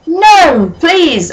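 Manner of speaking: high hammed-up plea; mock begging. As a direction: beg dramatically. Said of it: "I begged".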